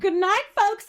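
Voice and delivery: high, loud, failed American country accent; attempted country accent. Shown as a demonstration. Answering the accent question, a loud high attempted country accent. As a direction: try the country accent and miss. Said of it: "Do you have to do it in the accent?".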